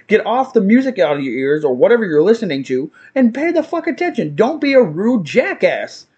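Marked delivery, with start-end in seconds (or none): 3.1-6.1 indignant scolding. Real, not acted. Scolding rude customers at rant's peak.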